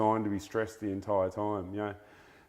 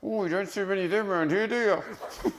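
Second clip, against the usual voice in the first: high and fast, frog voice suddenly backpedalling; frightened frog; 11 semitones higher than usual. Acted. Scared frog quickly fibs to the crocodile, high and fast. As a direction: squeak it out quickly, covering up. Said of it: "And the wide-mouthed frog goes".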